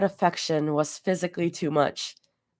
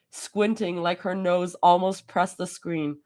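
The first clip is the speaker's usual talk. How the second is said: ordinary delivery, nothing marked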